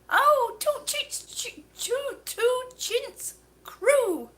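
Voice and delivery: high, slow sounding out of "two chintz crew"; stumbling over a name. Real, not acted. Reading a hard viewer name aloud. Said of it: "that's a mouthful".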